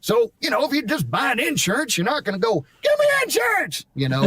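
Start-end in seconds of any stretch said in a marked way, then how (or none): 2.8-3.9 very high, wild cartoon demand; squeaky crazy coot cry; Dynamite voice. Shown as a demonstration. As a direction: yelp it like a mad old miner. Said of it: "talk like him".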